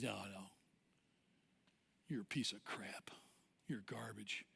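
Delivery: low, whispered put-down; dirty nagging voice. Acted. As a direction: whisper insults like a voice inside the head. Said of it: "dirty, nagging voice".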